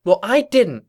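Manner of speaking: high, loud indignant comeback; snapped retort. A narrator's reading. Twilight denying she voted for her, sharp snap. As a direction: snap it back with force. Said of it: "Twilight snapped".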